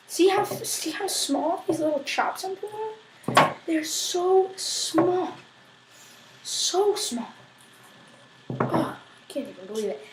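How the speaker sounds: very soft, slow marvelling; hushed awe. Acted. Cook marvelling at tiny garlic pieces, hushed awe. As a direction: marvel under your breath, drawn out.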